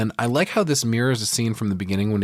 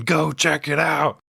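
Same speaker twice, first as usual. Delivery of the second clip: slow, higher mock yell; 6 semitones higher, slower than usual. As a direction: drag out a taunting yell through the door. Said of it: "he keeps on yelling".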